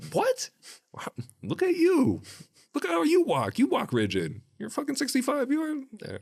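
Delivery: high, indignant teasing comeback; going at him. Retold or imitated. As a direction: fire back, sassy and mocking. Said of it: "I was just going at him anyways".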